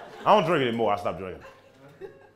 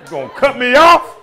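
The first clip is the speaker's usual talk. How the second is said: loud, high, outraged question; hammed-up angry yell; mock indignant challenge; 8 semitones higher, 11 dB louder than usual. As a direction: confront the driver, pitching way up. Acting out being cut off.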